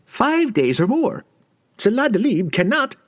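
high, eager merchant voice; nervous merchant. A narrator's reading.

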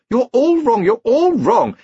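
very high, loud repeated insistence; heated squabble. Acted. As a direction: shout them all down. Wizards squabbling, voices over each other.

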